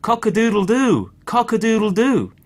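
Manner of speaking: high, loud crowing; a storyteller's loud rooster crow. A narrator's reading. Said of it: "And the cock crowed".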